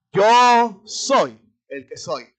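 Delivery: slow, loud, solemn divine voice; voice of God. Acted. God speaking to Moses from the bush, slow and loud. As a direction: intone it like God from the burning bush.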